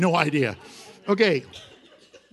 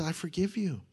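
gentle forgiveness; 8 dB quieter than usual. Shown as a demonstration. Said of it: "No, he says".

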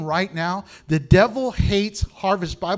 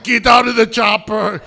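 put-on louder, heavy action-hero accent; Arnold Schwarzenegger; 3 semitones higher, 11 dB louder than usual. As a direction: bark it like a movie muscleman. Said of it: "He sounds just like him".

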